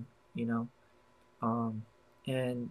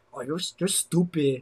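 voice she hates; 2 semitones higher than usual. Retold or imitated. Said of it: "If she talks a certain way".

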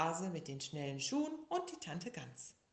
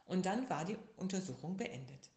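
usual speaking voice

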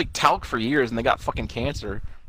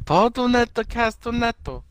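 mock Japanese intro; 10 semitones higher, slower than usual.

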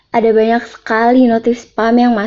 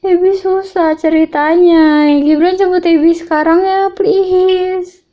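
snotty crying; 9 semitones higher, 3 dB louder, slower than usual. Acted. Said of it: "Abi kenapa nangis?".

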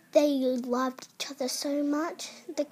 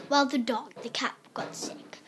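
plain reading voice

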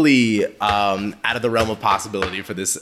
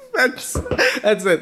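laughing; 10 semitones higher, slower than usual. Real, not acted. Laughing after the accent bit.

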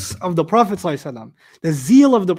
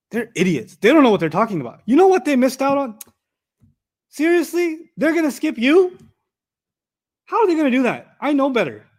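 mock indignant friend; 6 semitones higher than usual. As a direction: pitch climbing higher, outraged on a friend's behalf. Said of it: "I'm mad".

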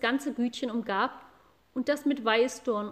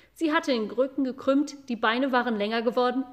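ordinary delivery

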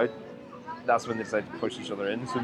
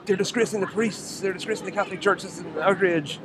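mock outrage; 8 semitones higher than usual. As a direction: play a pearl-clutching viewer. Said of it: "It was like".

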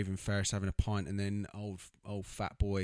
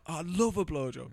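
high, put-on voice; lightly voiced character quote; mock character voice; 8 semitones higher, 4 dB louder than usual. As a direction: say it like a dopey character. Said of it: "he's like".

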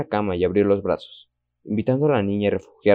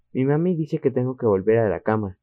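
ordinary delivery, nothing marked

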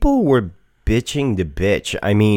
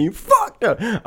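mock whine; 14 semitones higher, 4 dB quieter, slower than usual.

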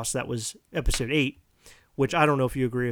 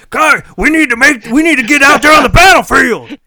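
loud military guy; 9 semitones higher, 17 dB louder than usual. Retold or imitated. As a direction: bellow orders like a drill sergeant. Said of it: "He talked like this".

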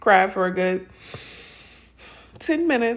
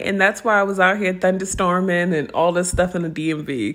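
normal talking voice

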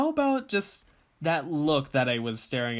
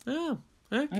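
high mock voice; 11 semitones higher than usual. Echoing in a put-on voice.

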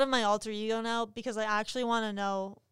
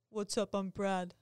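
deep bro voice; 3 semitones lower, 5 dB quieter than usual. Pretend. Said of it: "this is Brad".

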